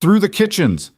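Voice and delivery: high, loud shout. A narrator's reading. Thomas shouts directions to the fleeing group, loudly. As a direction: call the turn over the alarm. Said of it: "Thomas shouted".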